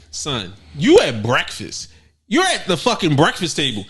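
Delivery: ordinary talk, nothing marked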